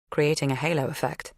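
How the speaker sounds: usual speaking voice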